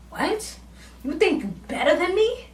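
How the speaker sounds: high, rising mock challenge; offended disbelief. Drunk Carol challenging Frida, offended and high-pitched. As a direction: question it in high, affronted disbelief.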